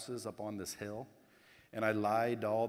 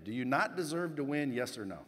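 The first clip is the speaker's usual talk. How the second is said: usual speaking voice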